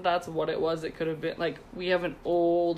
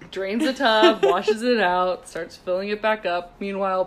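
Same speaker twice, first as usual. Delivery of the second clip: normal talking voice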